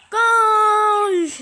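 loud goal cry; goal shout. Real, not acted. Scores a goal.

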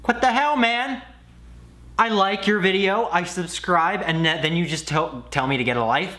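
hammed-up offended protest; mock outrage. Outraged at being told off.

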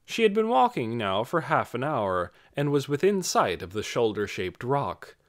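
ordinary delivery

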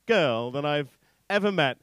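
ordinary delivery

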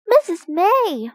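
high-pitched call to the teacher. A narrator's reading. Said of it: "called Biff".

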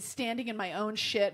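ordinary delivery

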